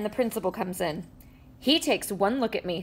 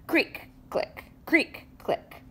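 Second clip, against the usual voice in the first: whispered creak click; 5 semitones higher, 5 dB quieter, slower than usual. A narrator's reading. Tow truck strains.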